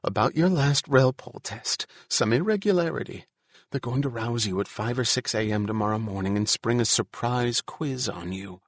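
ordinary delivery

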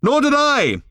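high, loud shout. A narrator's reading. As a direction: yell it straight back. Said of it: "shouted Arthur back".

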